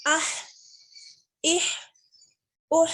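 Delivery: आह with air pushed from the throat; breathy throat h. Shown as a demonstration. Teacher to class, airy throat h. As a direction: let air out on आह, इह, उह. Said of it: "एक हवा आती है मुंह से".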